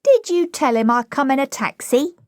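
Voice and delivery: put-on high-pitched, saucy cockney girl; indignant flower girl. Flower girl standing up to Higgins, indignant. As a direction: protest in a high cockney girl's voice.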